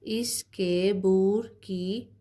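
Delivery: very slow, drawn-out sentence reading; slow dictation reading. Acted. Teacher reading a practice sentence to her class, very slow. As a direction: stretch every word so children can follow.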